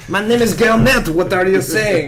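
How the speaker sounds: put-on French accent, affronted; hammed-up offended Frenchman with a French accent. Gilnet objecting to being called Larry, in French accent. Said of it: "Can you do a French accent?".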